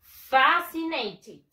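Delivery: FAscinated, very slow, strong FA; slow syllables, "FA-si-nay-tid"; stress on first syllable. Shown as a demonstration. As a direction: draw out "fascinated" for repetition. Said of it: "Repeat after me".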